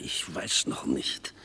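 leise geflüstert, zögernd; heimliches Flüstern. Acted. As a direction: vorsichtig flüsternd ausweichen. Verschwörer berät heimlich. (Verschwörer flüstert dem Mitverschwörer zögernd zu)

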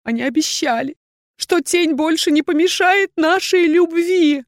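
tearful excuse from a heartbroken woman; sobbing. A narrator's reading. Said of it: "Она всхлипнула".

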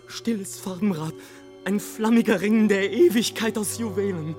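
high, swelling exclamation of wonder; rapturous awe. Acted. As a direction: cry out in ecstasy at the splendour. Ecstatic at storm before expected death.

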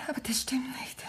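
very soft, insistent whisper; intense whisper. Acted. Wife insisting to her husband she heard steps, in an intense whisper. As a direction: whisper it firmly.

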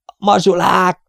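high, urgent yell; high-pitched cry of alarm; alarmed shout. A narrator's reading. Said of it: "ordította Raskal".

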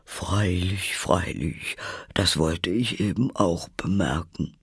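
drowsy, drawn-out character voice; sleepy Dormouse. A narrator's reading. Dormouse answers with eyes shut, drowsy. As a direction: mumble agreement from half asleep. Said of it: "ohne die Augen aufzutun".

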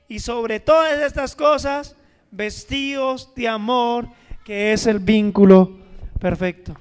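loud, slow, rising to a shout; preaching climax. Acted. Pastor proclaiming love as the bond, booming. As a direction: build to a booming cry on vínculo.

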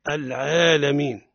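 ordinary talk, nothing marked

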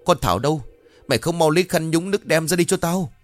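high, panicked shouting orders; high frantic screech at the stepdaughter; shrieking in pain. Acted. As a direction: yell out, clawed and stinging. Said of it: "vừa hét vừa chu chéo lên".

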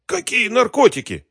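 very high, strained protest; panicked denial. A narrator's reading. Captive denies drugs to his interrogator, high and panicked. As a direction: squeak it out as a cornered captive.

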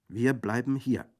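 put-on young women refusing to leave, loud and defiant; defiant cry. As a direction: shout it together. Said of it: "riefen die beiden jungen Mädchen".

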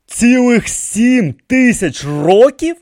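high, slow, rising disbelief; astonished question. A narrator's reading. King hears the priest's age, astonished. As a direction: stretch it out loudly, rising at the end. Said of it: "спитав вражений король".

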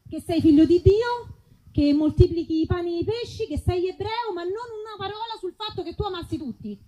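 normal talking voice

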